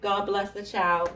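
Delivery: ordinary talk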